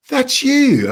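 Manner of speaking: high-pitched imitated gasp of recognition; mock astonished squeal; amazed recognition. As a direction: act out a starstruck realisation, voice leaping up. Acts out receptionist's amazed recognition.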